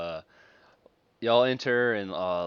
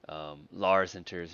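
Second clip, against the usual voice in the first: ordinary talk, nothing marked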